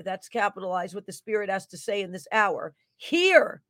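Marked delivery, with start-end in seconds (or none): 3.0-3.6 "Hear," called out high and loud; "Hear," ringing out as a single word; all capitals. Acted. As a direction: hit "Hear," like a shouted command. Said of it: "exclamation point, all capitals".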